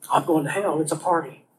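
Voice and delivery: a hint of a careless dude; cocky guy. Guy answers with tough nonchalance.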